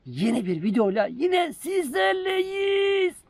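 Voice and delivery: drawn-out loud greeting call; shouting. Real, not acted. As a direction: call it out slowly across the hill. Greeting called out on hilltop.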